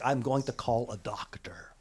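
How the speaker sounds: whispered, low; whispered aside. Acted. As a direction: whisper it worriedly. Principal decides to call doctor.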